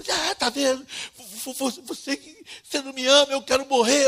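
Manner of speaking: high, loud, stammering protest; hammed-up despair of a beaten drunk; drunken wailing. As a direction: wail it high, tripping over the words. Drunk friend wailing while beaten, acted.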